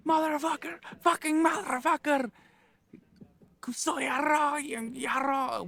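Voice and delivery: high, strained mock scream; retold screamed cursing, held back in volume; imitated screaming. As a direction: screech it like the ranting man. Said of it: "he's screaming".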